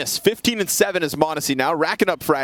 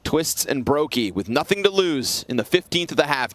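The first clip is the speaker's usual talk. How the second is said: ordinary delivery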